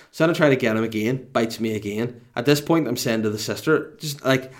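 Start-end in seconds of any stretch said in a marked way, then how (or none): none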